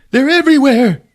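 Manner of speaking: high, loud panic; panicked. Retold or imitated. Podcaster voicing Chilton panicking, high and scared. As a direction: cry it out scared. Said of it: "they're like".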